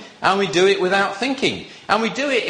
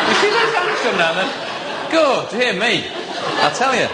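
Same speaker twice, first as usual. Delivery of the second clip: chuckling through the words, pitched up; amused laughing aside; 4 semitones higher than usual. Real, not acted. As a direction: laugh as the hands drop. Congregation reacts to show of hands.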